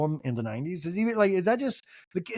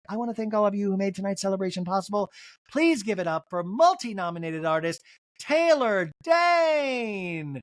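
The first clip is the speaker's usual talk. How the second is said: slightly raised stage announcement; 4 semitones higher than usual. Retold or imitated. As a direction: build to a long-drawn name reveal. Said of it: "then [name] comes out, like".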